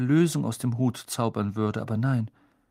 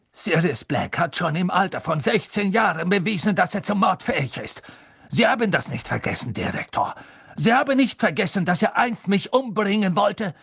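raised, agitated accusation; indignant outburst; 9 semitones higher, 5 dB louder than usual. A narrator's reading. Snape accusing the headmaster over an old murder attempt, indignant. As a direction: protest hotly, pushing the words out.

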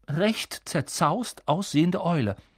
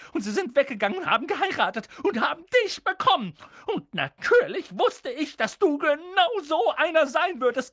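much higher, fast, sneering; scolding rant; 13 semitones higher than usual. A narrator's reading. Aunt Petunia scolds Harry about his parents, shrill and sneering. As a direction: pour out old contempt. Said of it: "fing dann erneut an zu schimpfen".

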